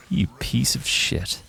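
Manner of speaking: slow whispered insult; whispered curse. Real, not acted. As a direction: breathe it out slowly, seething. Cursing dev third time.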